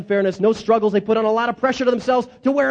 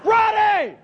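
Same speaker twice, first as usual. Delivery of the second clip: preaching at full force; 5 semitones higher than usual. Shown as a demonstration. Leading the congregation in call-and-response preaching, rising to a shout. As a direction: climb and roar the last line. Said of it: "Let's do it one more time".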